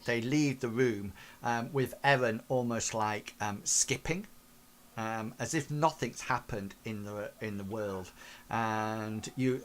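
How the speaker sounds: ordinary talk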